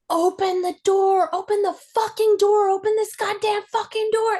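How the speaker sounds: high, raging yell at a locked door; furious yelling dad. Retold or imitated. Dad yelling through the bedroom door at his kid, enraged shout. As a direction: pound the door and scream to get it open.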